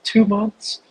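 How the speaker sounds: high, mocking voice of a naive newbie; mocking the interviewee. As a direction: play him up as a clueless newcomer. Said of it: "This dude in this interview is like".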